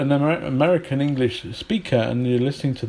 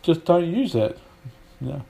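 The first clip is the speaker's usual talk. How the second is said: ordinary delivery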